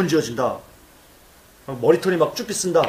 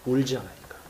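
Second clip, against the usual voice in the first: softer repeat of 울지 않으니까; quiet echo; 3 semitones lower, 9 dB quieter than usual. Real, not acted. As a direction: drop to a murmur on 울지 않으니까. (조용히 되뇌듯 "울지 않으니까")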